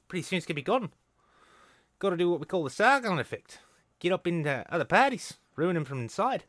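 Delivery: high, loud New Zealand accent put on. Mocking a nationalist in a loud, high New Zealand accent. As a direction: play it as a cocky New Zealand bloke. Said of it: "your New Zealand accent is".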